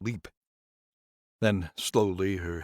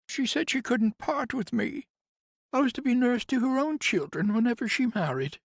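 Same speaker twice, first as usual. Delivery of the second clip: much higher, louder woman's voice, bitter; grieving nurse; 4 dB louder than usual. Acted. Nurse complains to Conrad about the house, higher voice. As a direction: lift into the nurse's aggrieved voice.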